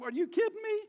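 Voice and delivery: sharp high cry of disbelief; incredulous. Real, not acted. Preacher to congregation, incredulous high outburst.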